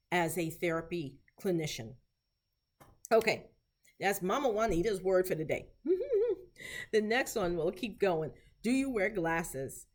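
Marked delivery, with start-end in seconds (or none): 5.8-6.9 short high giggle; a little high-pitched laugh; light chuckle. Real, not acted. Teacher amused after giving the word of the day, light chuckle.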